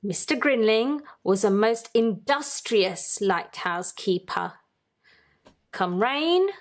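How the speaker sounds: ordinary delivery, nothing marked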